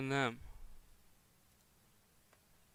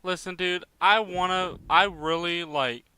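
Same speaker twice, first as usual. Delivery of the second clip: higher, louder exasperated plea; frustrated outburst; 4 semitones higher, 9 dB louder than usual. Real, not acted. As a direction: vent the frustration at the broken games. Frustrated rant about broken games.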